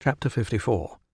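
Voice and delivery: ordinary delivery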